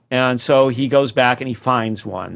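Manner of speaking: ordinary talk, nothing marked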